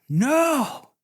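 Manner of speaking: high, loud cry of "No!"; a sharp, high yell of protest; retold yell. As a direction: shout it out as the dagger strikes. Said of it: "yell out".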